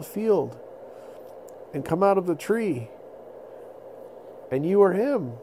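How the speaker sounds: high, earnest storytelling voice; wondering mammoth. Acted. Mammoth telling the boy of the prophecy. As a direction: tell it with wonder.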